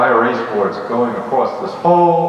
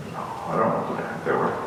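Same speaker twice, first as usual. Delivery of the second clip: hushed regret; 6 semitones lower, 9 dB quieter, much faster than usual. Real, not acted. Painful memory of the day.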